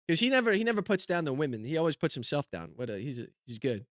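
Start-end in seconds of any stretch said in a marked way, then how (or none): none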